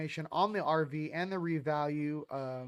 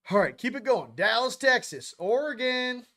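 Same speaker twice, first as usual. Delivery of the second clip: louder and higher, hyped up calling out places; excited shout-outs; 6 semitones higher, 7 dB louder than usual. Real, not acted. Streamer hyping viewers in chat, excited calling out. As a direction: pump up the crowd with each city name.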